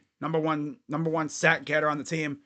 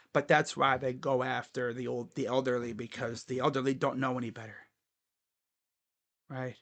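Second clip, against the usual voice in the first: ordinary delivery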